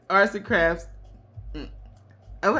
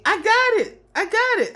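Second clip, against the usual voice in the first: sister. Retold or imitated. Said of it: "my sister called me ... and tells me".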